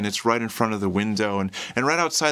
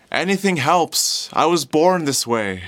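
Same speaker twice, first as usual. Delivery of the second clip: louder, drawn-out hopeful call; hopeful, optimistic; 3 semitones higher, 4 dB louder than usual. Acted. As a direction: call out to passers-by full of optimism. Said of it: "so full of life and optimism".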